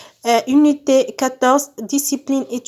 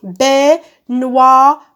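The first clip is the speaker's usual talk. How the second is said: Baignoire in three loud, separated beats; syllable by syllable; 5 dB louder, much slower than usual. Shown as a demonstration. Teacher clapping out syllables for pupils, syllable by syllable. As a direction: clap out Baignoire as BAI, GNOI, RE with gaps. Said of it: "Je frappe les syllabes".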